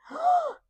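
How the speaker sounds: high imitated gasp of shock; outraged gasp. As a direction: gasp like a child hearing an insult.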